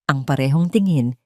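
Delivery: plain reading voice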